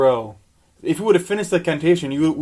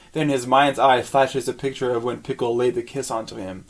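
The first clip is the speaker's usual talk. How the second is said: normal talking voice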